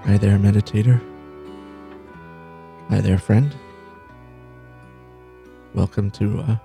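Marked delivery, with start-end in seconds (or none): none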